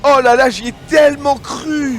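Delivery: loud, high, slow disbelief; real groan of excitement; excited shout. Real, not acted. Co-caster reacts to near steal.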